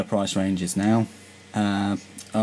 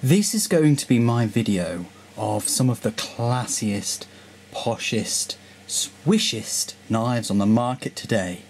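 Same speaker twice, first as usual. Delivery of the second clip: posh voice; slower than usual. Acted. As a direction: play it grand and plummy. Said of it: "enough with the posh voice".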